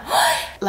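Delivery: sharp demo gasp. Shown as a demonstration. Showing viewers a French gasp. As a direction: suck in a quick startled gasp. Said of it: "a little bit of a gasp as well".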